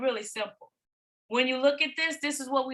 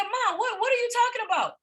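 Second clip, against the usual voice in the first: much higher, protesting daughter's voice; imitated daughter; 8 semitones higher than usual. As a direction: play the baffled child questioning mother, pitched high. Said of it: "And she was like".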